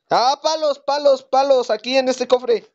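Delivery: high eager calling; excited shout. Real, not acted. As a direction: call out excitedly. Excited about sticks.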